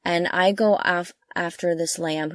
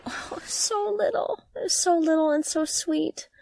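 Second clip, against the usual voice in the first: cooing; 9 semitones higher, 3 dB quieter than usual. Real, not acted. Gushing over the tiny lamb.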